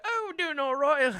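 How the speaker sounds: high, loud, drawn-out British reply; mimicked British accent. Answering like a parakeet, hammed-up British accent. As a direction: echo them in exaggerated, high British. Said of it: "talks in a British accent".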